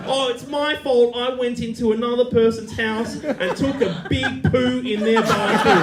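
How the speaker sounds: high, loud mocking sarcasm, laughing; sarcastic. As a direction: throw Mum's words back at her, mocking. Sarcastic mock of Mum's excuse.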